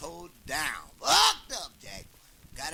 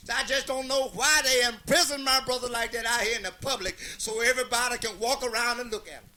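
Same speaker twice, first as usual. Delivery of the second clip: preacher pleading; 6 semitones higher, 6 dB louder than usual. Acted.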